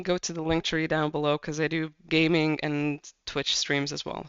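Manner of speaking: ordinary delivery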